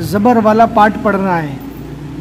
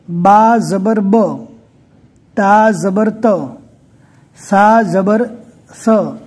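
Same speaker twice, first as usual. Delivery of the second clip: "बा ज़बर ब" spelled out slowly, letter name then mark then the short sound; teacher slowly spells each letter with ज़बर for students; slow spelled-out drill; much slower than usual. Shown as a demonstration. Teacher drilling students slowly, letter by letter, with the short vowel sound. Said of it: "बगैर खींचे पढ़ना है".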